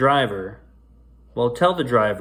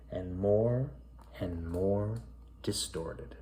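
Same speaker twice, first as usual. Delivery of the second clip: softer and softer down to a whisper; fading to a whisper; 4 semitones lower, 10 dB quieter, much slower than usual. A narrator's reading. Narrating the driver's face distorting, fading into a whisper. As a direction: let it sink away into a whisper.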